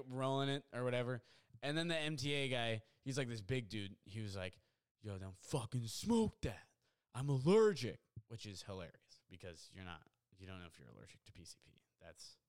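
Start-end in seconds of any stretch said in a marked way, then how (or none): 5.0-8.0 lightly acted confrontation, drawn-out words, louder finish; big gruff objector. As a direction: act out a big guy's protest. Big transit worker confronts drug user.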